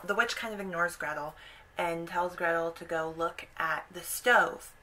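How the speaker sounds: ordinary talk, nothing marked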